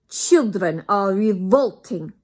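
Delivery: put-on loud, slow, high witch scream with a thick foreign accent. Grand High Witch screams at her witches, loud accented shriek. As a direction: scream it like a villain hating children. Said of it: "screamed the Grand High Witch".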